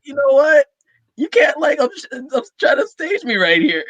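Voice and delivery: high, teary plea mid-laugh; half-crying as she retells it; tearful retold quote. Castmate retelling her own teary outburst, high and choked. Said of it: "And I was like".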